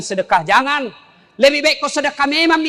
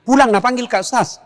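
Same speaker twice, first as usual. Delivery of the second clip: ordinary talk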